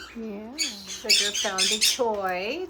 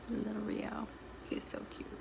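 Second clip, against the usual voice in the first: soft unvoiced whisper; 13 dB quieter than usual. Real, not acted. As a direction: whisper so the bird stays calm.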